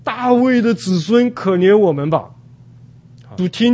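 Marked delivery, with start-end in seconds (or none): 0.0-2.3 raised, higher cry for mercy; loud calling out. Retold or imitated. Blind men calling to Jesus at the city gate, crying out loudly. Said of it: "他们大声地呼喊说".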